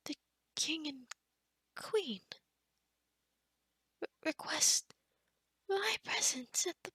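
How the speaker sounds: halting, stammered disbelief; anxious, slowed questions with broken starts; flustered and nervous. Acted. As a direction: falter word by word, taken aback. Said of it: "now I'm nervous".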